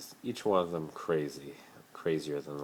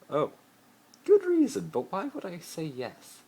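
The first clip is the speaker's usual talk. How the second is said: lightly raised, haughty schoolboy voice; a hint of a posh rich boy pleading; Malfoy voice; 7 dB louder than usual. Malfoy pleading with Harry to play his boyfriend, lightly voiced.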